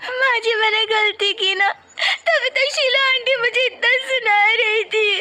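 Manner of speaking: crying. Acted. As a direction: sob it out to mother-in-law, slowing down. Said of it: "Surabhi रो रही है". (सास से रोते हुए कहती बहू)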